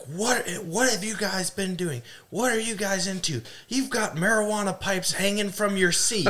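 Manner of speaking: high-pitched mock panic of a worried mother; frantic mom. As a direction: play the mom who thinks she found drug pipes. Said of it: "And she's like".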